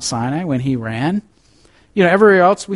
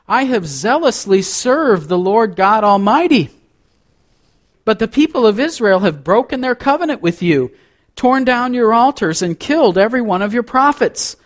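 raised, aggrieved complaint with a touch of self-pity; 8 semitones higher than usual. A narrator's reading. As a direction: plead your case, a little overdone. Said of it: "a little bit of exaggeration and self-pity".

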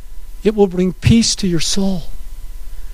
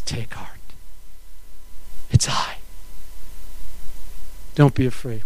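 much softer, lower and unhurried; calm them gently, almost hushed; tender reassurance; 8 semitones lower, 5 dB quieter than usual. A narrator's reading. Jesus comforting his terrified disciples, soft tender reassurance.

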